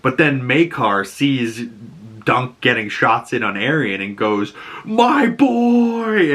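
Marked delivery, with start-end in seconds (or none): 4.8-6.3 high, drawn-out mock holler; imitated alarmed cry. Maekar sees Dunk getting shots in on Arian, hammed-up cry. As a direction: cry out for your son, hammed-up. Maekar retold crying out for son.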